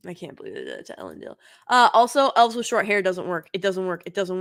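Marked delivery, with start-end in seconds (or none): 0.1-1.3 muttered aside. Real, not acted. Muttered aside about the show.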